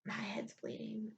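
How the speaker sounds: soft, slow whispered mockery of his panic; hushed mimicking whine; mocking whisper. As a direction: whisper it like a mock victim. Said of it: "And he freaked out".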